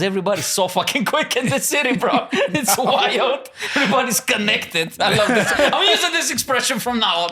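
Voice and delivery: laughing, high, excited chatter; bubbling over with amused delight; giddy excited. Real, not acted. Guest delighted by a new expression, laughing excitedly.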